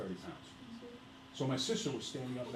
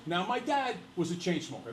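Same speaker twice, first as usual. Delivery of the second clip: normal talking voice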